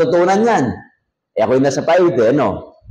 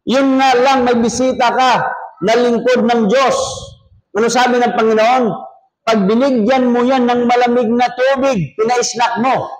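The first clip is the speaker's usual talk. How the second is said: raised preaching; 7 semitones higher than usual. Acted. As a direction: hammer home the promise of reward like a preacher. (masiglang pangangaral, bahagyang mas mataas ang boses)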